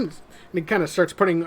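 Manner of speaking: normal talking voice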